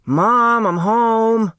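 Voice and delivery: high loud call into the apartment. Acted. Turtle calling to her mother on arriving, high loud call. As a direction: call out as you come through the door.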